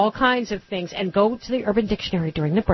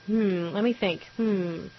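mock pondering; 5 dB quieter, slower than usual.

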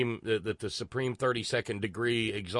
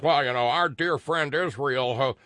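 raised, louder mimicked defender; mock apologist; 4 semitones higher, 5 dB louder than usual. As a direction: parrot a smug talking point, pitched up. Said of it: "whenever somebody says".

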